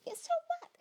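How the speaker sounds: squeaky, soft backtalk; defiant high self. Acted. Reluctant self talking back to the countdown, high defiance. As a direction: answer back in a high little voice.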